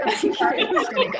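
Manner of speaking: high laughing question; giggling through the question; laughing at who goes first. Real, not acted. Laughing about staring contest.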